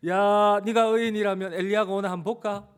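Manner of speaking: loud, high taunt; jeering. Acted. Crowd challenging Jesus to summon Elijah, jeering. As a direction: call out in open scorn. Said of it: "침을 뱉고 조롱하고".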